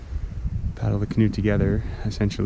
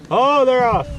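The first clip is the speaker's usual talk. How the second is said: excited; 14 semitones higher, 6 dB louder than usual. Real, not acted. Geese take off, watching John.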